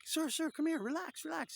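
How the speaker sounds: high, loud calling voice; acted high-pitched street vendor; urgent newsboy impression. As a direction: call him over, comically urgent. Acting newsboy calming the man.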